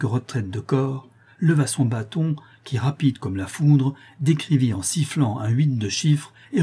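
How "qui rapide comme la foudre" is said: ordinary delivery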